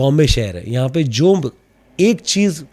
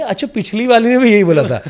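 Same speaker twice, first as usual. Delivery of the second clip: amused realisation; 7 semitones higher, 6 dB louder than usual. Retold or imitated. Catching the repeated line.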